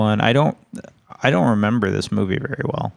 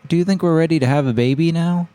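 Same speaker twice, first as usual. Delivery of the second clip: eager wife voice; 7 semitones higher than usual. Retold or imitated. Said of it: "she's like".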